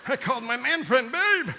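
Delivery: high, loud comic dismay; hammed-up high-pitched mortification; mortified wail. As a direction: wail it in mock horror, climbing at the end. Horrified at his own slip.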